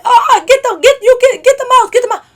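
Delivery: high, loud panicked pleading; mock panic. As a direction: imitate a frantic man pushing someone forward. Acts out his panicked pushing.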